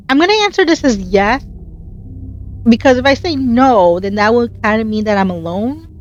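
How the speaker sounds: usual speaking voice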